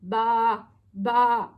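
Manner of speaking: loud sheep baa; sheep bleat. Acted. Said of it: "said the sheep".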